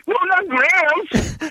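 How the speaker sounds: high, drawn-out mock pleading; hammed-up frightened whine; mock panic. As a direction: imitate a panicking tough guy begging. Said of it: "guy just started fucking panicking".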